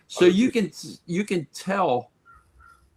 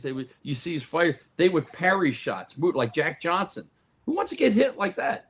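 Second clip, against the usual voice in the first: ordinary talk, nothing marked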